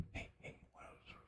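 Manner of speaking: very soft mock whispering; imitated whisper. Moviegoer leaning in to a partner mid-film, imitated whisper. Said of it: "They were whispering back and forth".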